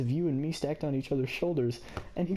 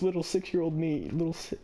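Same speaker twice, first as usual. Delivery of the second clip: usual speaking voice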